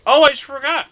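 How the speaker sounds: sudden realization. Real, not acted. Realizes he is muted.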